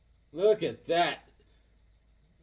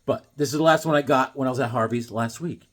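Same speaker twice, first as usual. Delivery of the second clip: ordinary delivery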